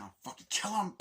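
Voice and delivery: high-pitched mock rage; acted furious threat. Girl vows to kill John Wick, furious cry.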